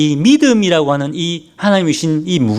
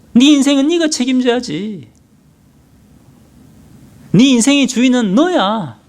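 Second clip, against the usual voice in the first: tempting Satan; 6 semitones higher than usual. Retold or imitated. Satan goading King Saul in a crisis, higher and pushy. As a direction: goad Saul like a serpent. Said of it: "똑같이 우리를 유혹합니다".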